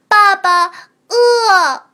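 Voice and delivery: put-on high, loud whiny baby creature; a hungry little hatchling; thin reedy voice.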